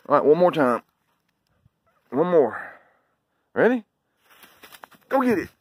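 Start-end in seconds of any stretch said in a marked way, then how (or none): 3.5-3.8 eager high ready. Real, not acted. Hyping dog before throw. 5.1-5.5 high, eager call to the dog; excited command. Real, not acted.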